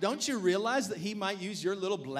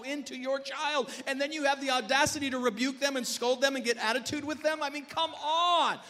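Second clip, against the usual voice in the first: higher-pitched, building to a shouted challenge; exasperated preacher's rebuke; 5 semitones higher, 4 dB louder than usual. Real, not acted. Pastor rebuking parents who scold volunteers, exasperated preaching. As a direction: take them to task, rising in force.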